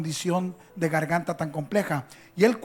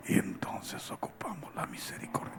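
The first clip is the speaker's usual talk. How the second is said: a whisper meant as a shout; Bob Sorge's whispered shout; 13 dB quieter than usual. Retold or imitated. Imitating Bob Sorge preaching at full effort in a whisper. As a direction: shout with no voice at all. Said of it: "Y él está gritando".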